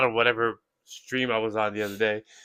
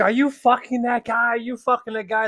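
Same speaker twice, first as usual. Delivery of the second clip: mock furious girlfriend; 9 semitones higher, 3 dB louder than usual. Pregnant girlfriend confronting.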